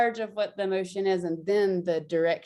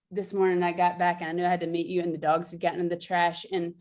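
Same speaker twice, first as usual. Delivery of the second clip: ordinary talk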